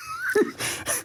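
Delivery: squeaky burst of laughter; high-pitched giggle; high squealing laugh. Real, not acted. Laughs at own answer.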